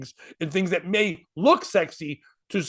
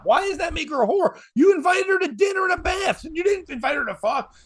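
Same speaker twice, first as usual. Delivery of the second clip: indignant; 12 semitones higher than usual. Real, not acted. Arguing with co-host.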